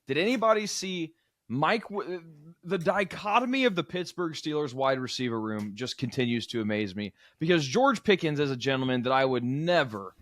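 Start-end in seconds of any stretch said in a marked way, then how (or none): none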